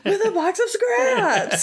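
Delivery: slow, high, laughing quote; Jeff Bridges delivery. Retold or imitated. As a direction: drag out the villain line. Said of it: "That was so good".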